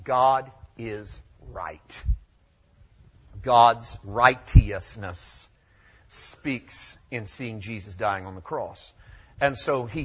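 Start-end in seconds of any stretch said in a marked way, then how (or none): 0.0-2.0 very slow "God is right", RIGHT set apart; emphasis on RIGHT. Shown as a demonstration. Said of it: "Emphasize right". 3.4-5.5 righteousness very slowly as "RIGHT-ee-us-ness"; old English pronunciation. Shown as a demonstration. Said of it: "when it was pronounced in the English four hundred years ago".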